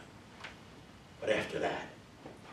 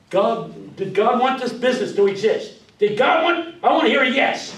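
loud, high revival call to the crowd; preacher calling out; 8 semitones higher, 12 dB louder than usual. Shown as a demonstration. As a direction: rouse the room like a Pentecostal preacher. Preacher demo, calls for response.

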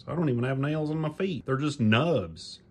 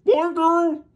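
much higher, louder shouted thank you; 7 dB louder than usual. Acted. Dog puppet yelling thanks to Damien for the gift. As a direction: holler your gratitude.

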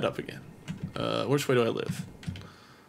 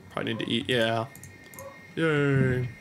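normal talking voice

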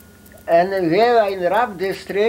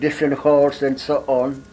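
ordinary delivery

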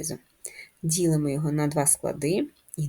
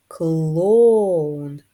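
"Клоун" with a loud, long КЛО; stress on first syllable; 8 dB louder than usual. Shown as a demonstration. Teacher showing first graders the stress in clown. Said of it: "визначимо наголос в слові клоун".

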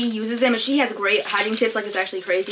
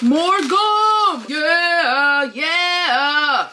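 high, loud gleeful cheer; high, louder sing-song cheer of joy; excited; 6 semitones higher, 4 dB louder, much slower than usual. Real, not acted. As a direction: squeal it out with delight. Excited reaction to gum in package.

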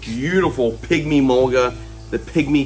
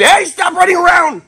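shouting; 9 dB louder than usual. Real, not acted. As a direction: yell to stop the running. Yelling at a kid.

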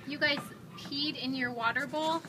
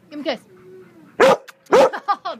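normal talking voice

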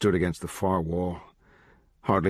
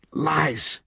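higher, accusing outburst; indignant denial; 9 semitones higher than usual. Acted. Kid rejecting the judge's claim, indignant denial. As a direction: throw the accusation back at him.

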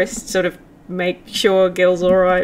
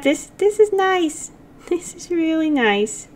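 soft, higher, tender voice; tender contentment; 6 semitones higher, 6 dB quieter than usual. Acted. Psychopomp being hugged by Gil, tender and content. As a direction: softly marvel at the comfort.

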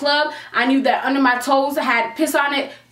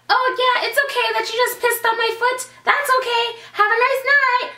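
much higher, sugary mock politeness; fake sweet; 10 semitones higher than usual. Mocking what she'd say if she were nice, sugary sarcasm. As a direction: play the nice girl you weren't, sarcastically sweet.

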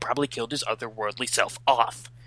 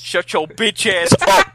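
loud, high yell; playfully yelling at the co-actor; mock angry yell; 9 semitones higher, 10 dB louder than usual. Real, not acted. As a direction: blast it at them.

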